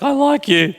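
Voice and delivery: voice breaking. Retold or imitated. Pastor replays his date confession, voice breaking high. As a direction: voice cracks up high on like. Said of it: "Yes, my voice broke".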